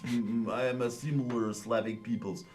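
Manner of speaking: put-on Ukrainian accent. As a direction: proclaim it in a Slavic accent, then settle. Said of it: "all the Slavic accents".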